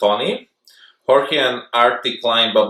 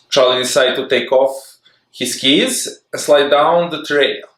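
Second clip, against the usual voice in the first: usual speaking voice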